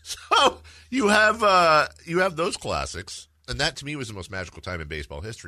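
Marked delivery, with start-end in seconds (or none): 0.0-3.3 high, slow words through laughter; laughing. Real, not acted. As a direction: laugh while talking. Laughing after Fairley quote.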